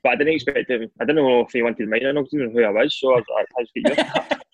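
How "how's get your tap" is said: high-pitched sheepish request; high awkward ask. Retold or imitated. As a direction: ask shyly in a squeaky voice. Retold awkward request to Nani.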